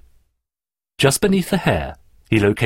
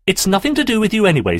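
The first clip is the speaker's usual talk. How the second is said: high, fast retort; snappish; 10 semitones higher, faster than usual. A narrator's reading. Daphne puts Terry in his place, snapping. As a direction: snap back sharply. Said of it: "snapped Daphne".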